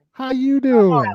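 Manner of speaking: high, loud, slow drawn-out flirty hello; flirty greeting. Retold or imitated. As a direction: ham up a syrupy come-on greeting. Imitating Don Lemon's greeting.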